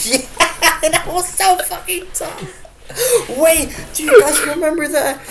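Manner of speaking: high-pitched laughing through words. Real, not acted. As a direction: squeal the words while laughing hard.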